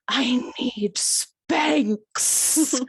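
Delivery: hissed urgent whisper, dragged out slow; whisper-shout it like a secret emergency; mock whisper yell, very slow. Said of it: "she whisper yells at me".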